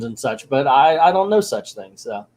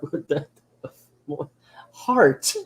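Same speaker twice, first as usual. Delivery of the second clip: incredulous; 4 dB quieter, slower than usual. Real, not acted. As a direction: squeak the word out, amused and baffled. Baffled reaction to the book.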